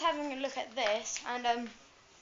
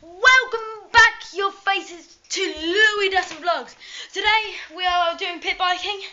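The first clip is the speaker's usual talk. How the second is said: excited vlog intro; 5 semitones higher, 10 dB louder, slower than usual. Acted. Energetic vlog intro to camera.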